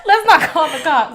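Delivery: high, loud repeat of the plea; mock pleading. Retelling pleading with upset driver.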